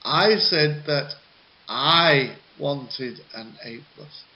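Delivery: "I said that" pushed up and out; stress on that I. Shown as a demonstration. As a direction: insist on I said that. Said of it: "where the stress words are".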